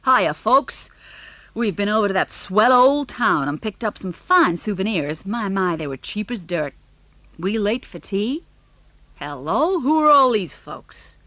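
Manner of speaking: a lightly voiced burly American; brash American father greeting the room. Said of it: "loud American voices".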